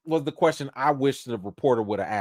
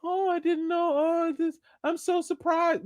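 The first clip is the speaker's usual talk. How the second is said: mock surprise; 4 dB quieter than usual. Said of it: "So I don't wanna hear".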